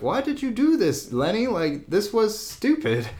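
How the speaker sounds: high, slowing mock-father scold. The father scolds his son.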